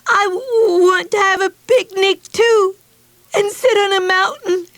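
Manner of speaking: very high, loud sobbing plea. Acted. Betsy sobs into Mother's lap, heartbroken. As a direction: beg through sobs. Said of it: "sobbing as if her heart were breaking".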